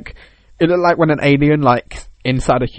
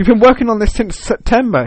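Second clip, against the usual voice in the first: incredulous colleague, acted; 8 semitones higher, 4 dB louder than usual. Acts colleague challenging lazy developer.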